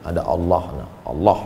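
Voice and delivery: low, soft and calm; reassuring parent. Pretend. Mother comforts her scared child at bedtime, softly. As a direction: soothe a frightened child at bedtime.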